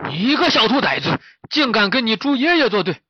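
high, loud scolding boar voice; angry wild boar. Acted. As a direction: rage at the cheeky youngster as a blustering boar. 野猪被小猪捉弄后骂它. (野猪怒声叫骂)

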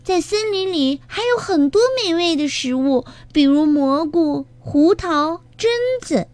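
put-on high, childlike chick voice; little one listing foods, pitched very high. A narrator's reading. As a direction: pipe up sweetly in a small, high voice. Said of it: "卡门接着说".

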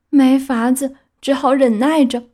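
high, resigned little donkey voice; donkey's inner voice. A narrator's reading. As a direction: sigh it to himself. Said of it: "他又说了一遍". (皮诺乔无奈自语)

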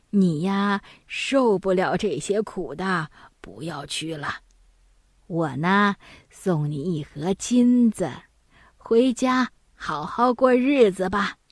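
put-on chuckling old woman. Old woman sends daunted son home with gold, chuckling. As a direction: laugh lightly and talk him out of it. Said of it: "笑着说".

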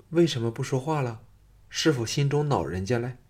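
ordinary delivery

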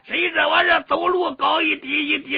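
eager little brother. Acted. Little brother finds big brother after sneaking from home, plaintive and excited.

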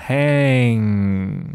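hang, back nasal ng held; heavy back nasal. Shown as a demonstration. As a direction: hang with a long ringing ng in the nose. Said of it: "这个后鼻音".

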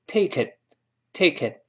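"take it" linked, t stopped on the tongue; "tay-ki(t)" with no burst at the end; unreleased final t. Shown as a demonstration. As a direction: hold the last t of "take it" silently. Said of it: "最后那个t失去爆破".

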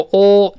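"o" with lips pushed out, loud aw; pouted British vowel. Shown as a demonstration. Teacher shows the effortful vowel, "o" pouted and loud. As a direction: pout hard on a long "o". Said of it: "撅嘴呢特别费劲".

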